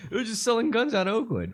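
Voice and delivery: mock it in a squeaky voice; high squeaky voice. Mocking squeaky voice.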